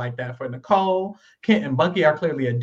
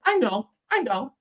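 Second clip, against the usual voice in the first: higher, brushing it off; higher mock dismissive repeat; dismissive mock quote; 8 semitones higher than usual. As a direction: toss it off like she's bored. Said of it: "And Shayna's like".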